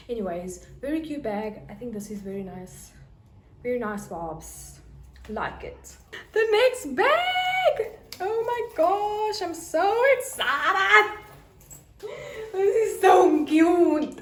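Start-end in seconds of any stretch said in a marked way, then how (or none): 6.3-14.2 excited squeal. Real, not acted. Squealing over new bag.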